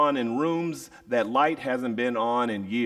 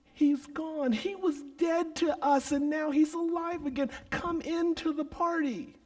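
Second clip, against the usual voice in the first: much higher, soft pleading voice; pleading father; 4 dB quieter than usual. Acted. Father urging elder son into the party, high and soft. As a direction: beg him gently to join.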